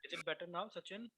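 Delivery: faint, distant mic sound; barely audible. Real, not acted. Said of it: "No, sir".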